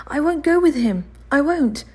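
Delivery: put-on high, loud boy's protest; a frightened child crying out refusal; boy refusing the chimney sweep, high and loud; cried out. A narrator's reading. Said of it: "cried Oliver".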